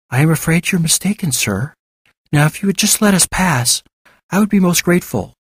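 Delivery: high, courteous feigned-woman voice; polite disguised Fatima. Acted. Fatima asks David to let them pass, high and polite.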